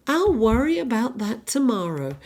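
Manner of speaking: hammed-up American Scarlett O'Hara; terrible American accent. Quoting Scarlett O'Hara from Gone with the Wind, terrible American accent. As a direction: put on a bad American accent. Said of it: "That's my terrible American accent".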